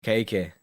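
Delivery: "Cake." said as "cakey", with an extra "ee" on the end; accent on cake. Shown as a demonstration. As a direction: stretch "Cake." into a two-syllable "cakey". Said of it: "in my shit accent".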